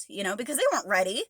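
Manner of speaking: normal talking voice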